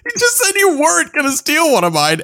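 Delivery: laughing accusation, voice up high; playful indignation. Real, not acted. Catching co-host stealing his pick.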